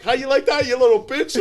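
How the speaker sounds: high, loud mocking taunt; taunting yell. Spanking the man in a snowbank, loud taunt. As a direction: gloat over a beaten man.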